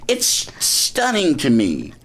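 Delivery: ordinary delivery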